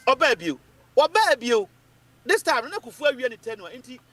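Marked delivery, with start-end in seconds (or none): 0.1-1.7 emphatic repetition. Real, not acted. Emphatic repeated political point.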